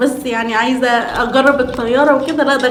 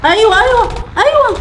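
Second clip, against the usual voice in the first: excited cheering; 8 semitones higher than usual. Real, not acted. Cheering a hit.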